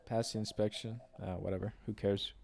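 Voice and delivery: very soft, low self-talk; muttered aside. Real, not acted. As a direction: trail into a dismissive mumble to yourself. Muttered aside to self while driving.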